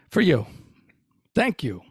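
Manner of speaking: ordinary talk, nothing marked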